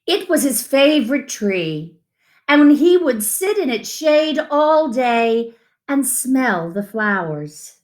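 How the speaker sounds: unhurried picture-book read-aloud; reading to children. Acted. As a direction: read it to little ones, savouring each line.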